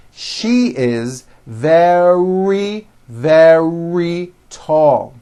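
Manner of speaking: very slow, "very" stretched twice on its R; slow, strong R. Shown as a demonstration. Teacher gives next one to practice, slow "very" with long R. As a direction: take it word by word, holding the R in "very". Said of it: "nice and slow".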